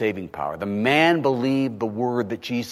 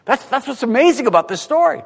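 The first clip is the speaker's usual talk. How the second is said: ordinary delivery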